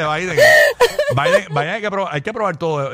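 laughing through words; trying not to laugh. Real, not acted. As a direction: giggles under the words. Still giggling about Biden.

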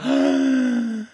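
loud, high gasp of surprise; shocked gasp. Real, not acted. Guest is shown the surprise, gasping in shock. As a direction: gasp hugely at the reveal.

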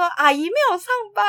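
quick, high lightly voiced toddler; child's insistent voice. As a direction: imitate the toddler repeating himself, a bit high and fast. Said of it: "他就说". (稍高稍快地模仿小孩的口气)

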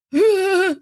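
big overwhelmed sigh; hammed-up weary sigh; exaggerated sigh. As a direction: let out a long mock sigh. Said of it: "my brain just goes".

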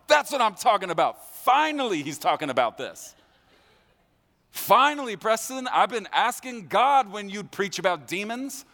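high, loud, gushing church fan; overjoyed believer. Retold or imitated. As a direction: gush like a fan finally hearing the sermon. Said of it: "you're going".

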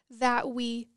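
normal talking voice

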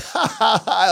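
high imitated laugh out loud; high, put-on "ha ha"; mock laugh. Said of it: "we started laughing out loud".

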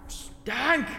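high call down into a hole. Acted. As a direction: call his name into the dark. Calling down into the crack.

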